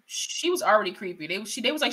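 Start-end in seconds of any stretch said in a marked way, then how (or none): none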